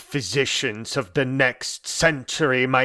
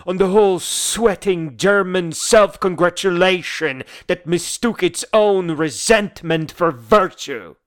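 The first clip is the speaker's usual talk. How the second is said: loud, high, emphatic denunciation; heated oratorical contempt; indignant tirade; 6 semitones higher, 5 dB louder than usual. Acted. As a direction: drive each accusation home, loudly. Tirade against German nationalism.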